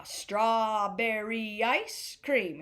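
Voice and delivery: very slow, every syllable stretched; drawn-out demo. Shown as a demonstration. Music teacher introducing the next rhythm phrase, drawn-out demo.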